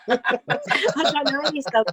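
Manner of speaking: slow, laughing through the words. Retold or imitated. Singer laughing at her own audition answer. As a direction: giggle it out, amused.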